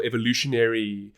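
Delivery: normal talking voice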